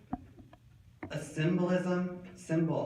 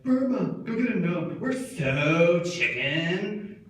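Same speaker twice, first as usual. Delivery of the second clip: mock cowardly friends; 2 semitones higher, 4 dB louder, slower than usual. Friends in the truck send him for a gnome, mock cowardly. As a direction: whine it out, dragging the last words.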